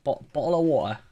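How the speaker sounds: a light British take on "bottle of water"; British accent. Shown as a demonstration. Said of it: "I need to say bottle of water".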